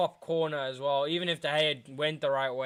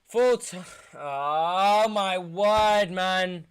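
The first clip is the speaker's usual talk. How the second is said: slow despairing groan; 3 semitones higher, 5 dB louder, much slower than usual. Real, not acted. Full-time whistle, fan despairs.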